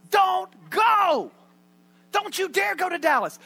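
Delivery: high, loud pleading shout; alarmed shout. Retold or imitated. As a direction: yell at him not to go. Advisors pleading with JFK.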